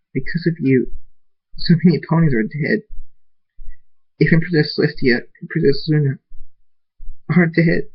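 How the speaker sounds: tearful blame. A narrator's reading. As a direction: blame them through tears, understated. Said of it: "Tears appear in Princess Cadance's eyes".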